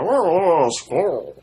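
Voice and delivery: a light mock deep-Texas oh, oh, squirrel; deep Texas drawl. Imitating someone from deep Texas saying squirrel in one syllable. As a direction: squirrel as a single Texan syllable, skwerl. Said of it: "somebody that was from even deeper Texas".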